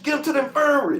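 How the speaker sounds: high, loud urgent command; shouted order. Retold or imitated. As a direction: call it out to get the wounded guy moved. Officer orders injured man moved.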